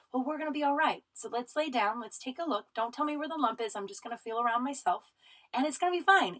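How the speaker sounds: high, sing-song reassurance, imitating a clinician; comforting. Clinician settling a scared patient before an exam, comforting. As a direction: soothe a frightened patient in a lifted, easy voice. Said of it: "like, so comforting".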